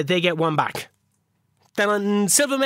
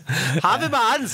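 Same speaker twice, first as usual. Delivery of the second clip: laughing echo; 5 semitones higher, much slower than usual. Real, not acted. Cohost echoes player name laughing.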